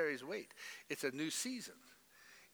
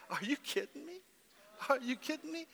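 much higher, disbelieving; incredulous; 10 semitones higher than usual. Retold or imitated. Pastor retelling what he says to squalling people, high disbelief. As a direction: ask it in amazed disbelief. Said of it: "I'm saying".

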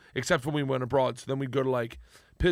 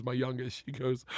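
normal talking voice

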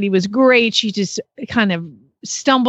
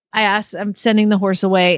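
ordinary talk, nothing marked

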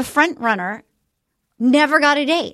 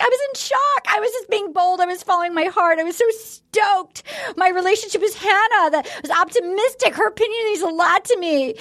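higher, breathless mock contestant; mock Heather; 5 semitones higher than usual. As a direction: parody her gushing excuses, pitched up. Imitating Heather's explanation.

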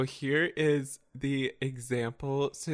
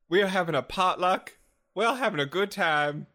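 higher, hammed-up protest; mock-wounded party host; theatrical reproach; 6 semitones higher, 4 dB louder than usual. Guest spoiled the potluck with a question, melodramatic protest.

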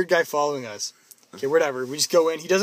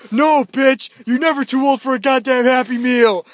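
loud, higher mock teen yell; 6 semitones higher, 8 dB louder than usual. Chris yelling at Ty, loud mock yell. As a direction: yell back like an offended teen. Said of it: "Chris was like".

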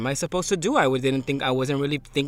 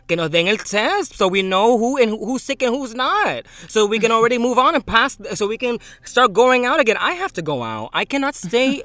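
higher, louder, fed-up venting; exasperated rant; 8 semitones higher, 7 dB louder than usual. Real, not acted. Friend venting about missing tests, exasperated and louder. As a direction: push it out, fed up and pleading.

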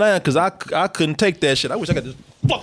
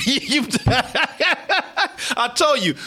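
normal talking voice

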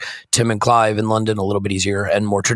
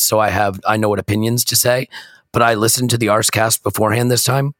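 usual speaking voice

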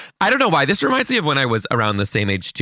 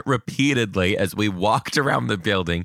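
usual speaking voice

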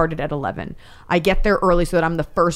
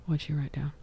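whisper; 3 semitones lower, 13 dB quieter than usual. Retold or imitated. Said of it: "And I go".

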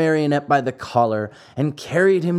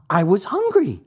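lightly voiced puppet boy, higher; Pinocchio's excuse; 6 semitones higher than usual. Puppet excuses himself to the glowworm, slightly childlike. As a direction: make a small, high-pitched excuse.

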